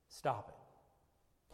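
breathy hushed "Stop it."; whispered stop. Shown as a demonstration. Preacher pleading softly with the congregation, whispered. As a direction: whisper "Stop it." close and low. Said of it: "preacher pleading with runaways".

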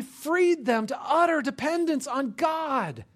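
high, loud sermon delivery; full-force preaching. Real, not acted. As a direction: preach it out at the top of your voice.

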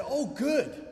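high, loud burst of pleased surprise; delighted surprise. Retold or imitated. Dinner guest hears the speaker is a minister, delighted surprise. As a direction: exclaim it with a big pitch jump. Stranger delighted at hearing his job.

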